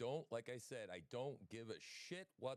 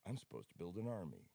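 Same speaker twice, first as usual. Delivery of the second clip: ordinary talk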